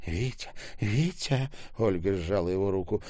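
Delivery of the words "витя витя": higher, pleading call; urgent pleading. A narrator's reading. Ольга squeezing Витя's hand, pleading. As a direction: call the name imploringly.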